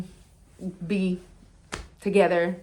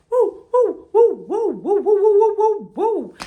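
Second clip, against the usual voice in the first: high, loud cheering woos with a laugh; excited woo; 11 semitones higher, 5 dB louder than usual. Real, not acted. Cheering about the sleepover, high loud woos. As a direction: whoop like a party.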